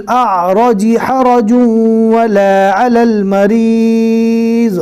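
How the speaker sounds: slow melodic tajweed chant; Quran recitation. Shown as a demonstration. Teacher reciting verses to the class, melodic and measured. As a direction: recite it, drawing out each verse.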